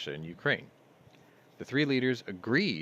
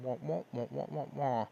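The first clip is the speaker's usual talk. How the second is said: higher wah-wah babble; mimicked droning voice; 7 semitones higher, 4 dB quieter than usual. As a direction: imitate a tuned-out voice as meaningless wahs. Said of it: "every time I hear your voice going".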